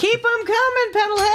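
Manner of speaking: very high excited cheer; squealing cheer. Real, not acted. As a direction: squeal it with delight. Host cheers on callers.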